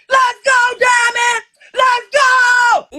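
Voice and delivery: high, loud chant for a win; cheering shout. Real, not acted. Guest cheering Diamond's win, loud cheering shout. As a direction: cheer it like at a game.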